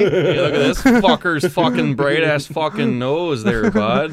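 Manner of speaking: mocking Canadian reindeer; teasing put-on Canadian accent. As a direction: jeer in a thick Canadian accent. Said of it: "very Canadian accent?".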